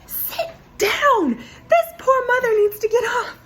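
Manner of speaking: mock outraged passenger yelling from the back; indignant shout. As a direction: yell at him to sit down for the mother. Lady in back scolds standing man.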